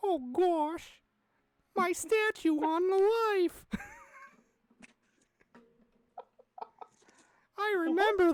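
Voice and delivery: high, loud squeaky cartoon mouse voice; Mickey voice. Friend says he sounds like Mickey, squeaky cartoon mouse. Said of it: "kinda sound like Mickey".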